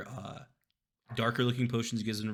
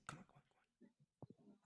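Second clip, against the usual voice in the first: whispering; 9 semitones higher, 25 dB quieter than usual. Acted. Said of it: "He's like".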